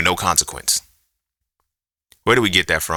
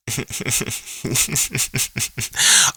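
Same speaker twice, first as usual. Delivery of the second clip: amused chuckle; 4 dB quieter than usual. Real, not acted. Laughing at posts seen in the feed, amused chuckle. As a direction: chuckle at the posts before quoting them.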